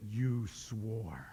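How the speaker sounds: very soft, low tattletale tone; grandkids' voice. Retold or imitated. Twins catch grandpa swearing, soft and low tattling. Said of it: "They said".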